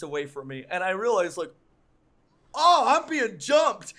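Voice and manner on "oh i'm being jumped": high, loud, drawn-out mock alarm; sudden alarmed realisation. Storyteller reliving the dude charging at him, mock alarm. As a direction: exclaim it as the attack dawns on you.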